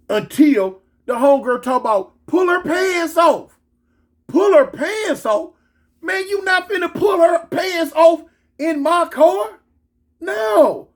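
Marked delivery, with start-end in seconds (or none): 2.3-3.6 high, drawn-out command; acted urgent order. 4.3-10.9 high, slow incredulous protest; hammed-up indignant refusal; acted outrage. Acted outrage at homegirl's order.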